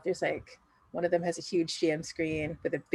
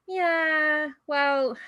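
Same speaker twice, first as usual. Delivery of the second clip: higher, stretched-out reluctant agreement; Rosario's drawn-out reply; 9 semitones higher, 4 dB louder than usual. Acted. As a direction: draw out each word, pitched up. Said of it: "the Rosario who's with you".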